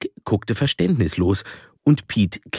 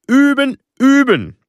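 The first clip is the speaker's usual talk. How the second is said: much higher and louder, hammering each üben; insistent shout; 8 dB louder than usual. A narrator's reading. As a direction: drill it in, each "üben" a loud high command. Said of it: "streng über seine Halbbrille schaute und rief".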